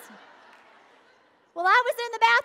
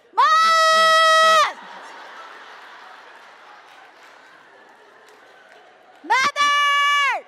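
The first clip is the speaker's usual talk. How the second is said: high, drawn-out full-voiced yell; hollering for mom; 6 semitones higher, 6 dB louder, much slower than usual. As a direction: holler for your mother at the top of your lungs. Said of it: "At the top of my lungs, I hollered".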